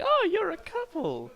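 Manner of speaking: very high, slow cooing; put-on high voice, drawn out; gushing ladies imitated. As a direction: coo it like an imitated delighted shopper. Shopping ladies' reaction acted out.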